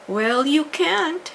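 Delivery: loud, slow, high snap of a refusal; stepmother's shout. A narrator's reading. Stepmother shouting down at Cinderella, loud and slow. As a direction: bark the refusal down at her. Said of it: "shouted the stepmother".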